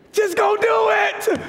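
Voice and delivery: high, laughing exhortation; laughing shout. Real, not acted. Preacher laughing at praying believers, high shout. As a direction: push them, laughing. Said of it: "Why are you praying".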